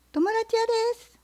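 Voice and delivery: fox. Acted. Fox voiced in story.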